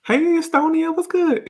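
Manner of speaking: very high playful hello; sing-song high shout-out without extra volume; high-pitched greeting. Pretend. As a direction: greet a viewer in a squeaky-high voice. Calls out greeting to viewer.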